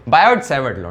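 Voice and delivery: "बायोट सैवर्ट" as bai-ot sai-vart; corrected pronunciation. Shown as a demonstration. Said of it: "सर्वा नहीं". ("सैवर्ट" सै-वर्ट बोलकर सुधारते हुए)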